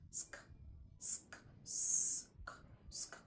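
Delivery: bare voiceless SK, repeated; blended SK sound. Shown as a demonstration. Teacher telling learners to blend it, isolated SK. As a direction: hiss the S into a K. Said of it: "Blend it".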